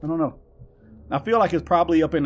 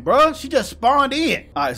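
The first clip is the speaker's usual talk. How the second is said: ordinary delivery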